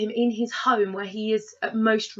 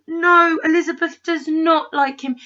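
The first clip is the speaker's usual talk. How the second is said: sudden loud, higher protest of No; exasperated protest; 6 semitones higher, 5 dB louder than usual. Real, not acted. Fan protesting to her friend, loud and exasperated. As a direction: blurt it out, higher and louder, indignant. Speaker's own outburst at the film.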